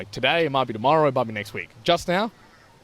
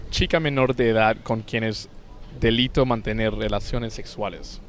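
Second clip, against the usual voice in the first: fluent Spanish pronunciation; Spanish accent, demonstrated. Shown as a demonstration. Showing co-host how to say it, Spanish accent. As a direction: show how the Spanish should sound, tapped r and pure vowels. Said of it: "I would say it as".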